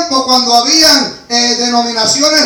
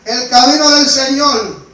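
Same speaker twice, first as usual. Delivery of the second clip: ordinary delivery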